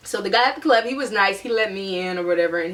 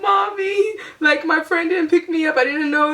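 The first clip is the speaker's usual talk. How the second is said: put-on higher, childlike crying to mommy; tearful whine; 8 semitones higher than usual. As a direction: whimper it like a stranded kid. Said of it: "and I'm just crying".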